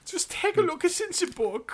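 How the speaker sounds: mock German jingle. Friend parodying the show's jingle, high mock German.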